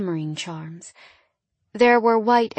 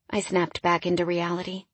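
ordinary delivery

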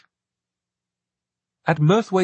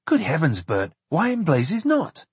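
exasperated surprise, pitched up; exclaimed disbelief; 5 semitones higher than usual. A narrator's reading. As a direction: demand a reason in astonishment. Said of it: "John exclaimed".

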